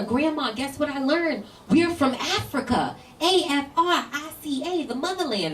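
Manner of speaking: proud second-grader sharing news; excited kid. Retold or imitated. Little girl telling grandma about Africa, eager and high. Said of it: "I was excited to tell her".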